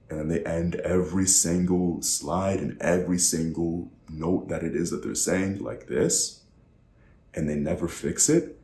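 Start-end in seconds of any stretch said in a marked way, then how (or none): 0.1-6.4 questioning uptick on "this"; upward inflection. Shown as a demonstration. Coach imitating unsure presenters, upward inflection. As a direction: run evenly along, then swing "this" up like a question. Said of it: "it makes it sound like they're really unsure".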